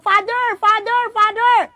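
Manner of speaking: high urgent calls of father, rising each time; child calling out. Retold or imitated. A child shouting for the priest outside, urgent calls. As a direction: call for the priest in a panic.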